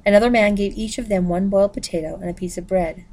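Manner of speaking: ordinary delivery, nothing marked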